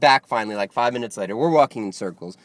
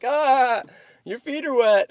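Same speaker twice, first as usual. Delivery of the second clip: high-pitched jeering of bar buddies; imitated group outcry, pitched way up; mock crowd teasing; 12 semitones higher, slower than usual. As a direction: tease in a much higher voice. Bar crowd teasing his wet feet.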